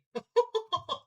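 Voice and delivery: high, loud haughty guffaw. Acted. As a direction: laugh out high and smug. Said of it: "Diamond guffawed".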